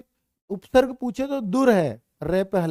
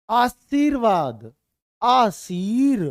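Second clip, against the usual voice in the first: 1 slow, stretched; 2 semitones higher, 4 dB louder, much slower than usual. Shown as a demonstration. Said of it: "तो देखिए रे जहां बोलेगा".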